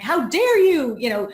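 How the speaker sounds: loud, indignant mock rant; mock indignant "how dare you" at the other woman; mock angry outburst. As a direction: act out a frustrated snap back at someone. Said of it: "super frustrated and angry and being like".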